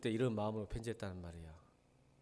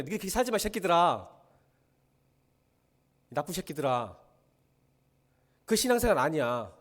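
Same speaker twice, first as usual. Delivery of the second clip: Paul's angry rebuke; 5 dB louder than usual. Retold or imitated. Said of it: "그렇게 썼단 말이야. 눈물 흘리면서".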